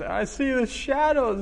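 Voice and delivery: put-on high little-boy voice; scared child voice. Child voiced in father's story.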